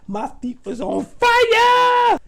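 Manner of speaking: high, loud, slow cry of pain; drawn-out pained wail, high and loud; anguished wail. Retold or imitated. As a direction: stretch it out like you're burning. Recalling the burn.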